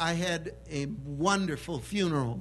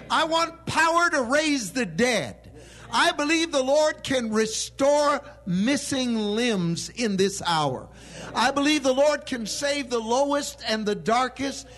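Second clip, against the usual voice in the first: full-force preaching; 6 semitones higher, 5 dB louder than usual. Acted. Preacher crying out for miracles, full-force preaching. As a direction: lift it to a roaring climax.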